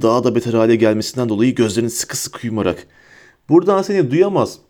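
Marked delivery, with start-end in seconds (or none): 3.5-4.6 high, mildly shouted taunt; raised taunt. A narrator's reading. Harry mocks Bellatrix's appeal to Voldemort, shouting mildly. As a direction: call out scornfully in a raised pitch.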